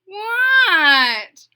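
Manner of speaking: high loud shout back; Froggy yells back from far away; shouted reply. Acted.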